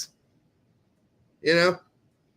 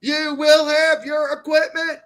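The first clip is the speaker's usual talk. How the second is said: higher barked sergeant order; drill instructor voice; 11 semitones higher, 5 dB louder than usual. Acted. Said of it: "drill instructor".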